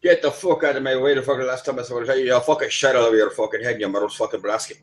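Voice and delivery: sweary Irish rant in a Dublin accent; hammed-up Dublin accent, starting high. Shown as a demonstration. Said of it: "that's more Dublin".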